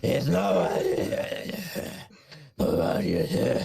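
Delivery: wheezing, voiceless superhero rasp; Batman. Pretend. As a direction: overdo the vigilante growl until it is all breath. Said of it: "It sounds like the Batman from".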